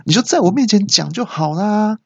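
high-pitched mock yelling; imitated exasperated cry. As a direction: complain in disbelief like the nurse. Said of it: "那小姐听了就大叫说". (学护士又气又急地尖声大叫)

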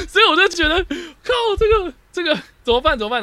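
high, loud whimpering panic; mock despair. Real, not acted. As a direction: wail it in comic despair.

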